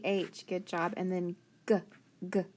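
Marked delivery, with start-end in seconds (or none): 1.7-2.5 "g" as a short hard "guh"; brief hard "g" bursts; isolated letter sound. Shown as a demonstration. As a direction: tap out "g" twice, apart. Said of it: "we're gonna try our spelling sounds".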